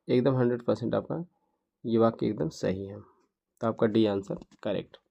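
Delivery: ordinary delivery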